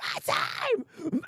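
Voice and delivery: hammed-up cry of triumph; mock hero cry. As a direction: squeal it like a hero seizing his chance. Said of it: "Maru's like".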